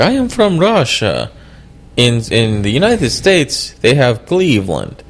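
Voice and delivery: deadpan Russian comic delivery; Yakov Smirnoff Russian accent. Retold or imitated. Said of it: "Yakov Smirnoff used to have a joke".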